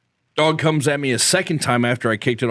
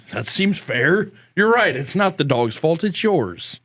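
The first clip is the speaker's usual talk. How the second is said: usual speaking voice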